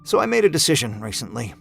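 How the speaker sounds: usual speaking voice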